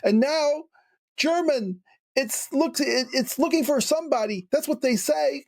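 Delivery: frightened hoteler. Acted. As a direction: pitch it up high as the panicked hoteler. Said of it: "And she says".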